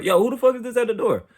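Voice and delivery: angry yell at whoever is knocking; frustrated yell. Retold or imitated. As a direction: burst out of the room yelling at the door. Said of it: "I'm frustrated".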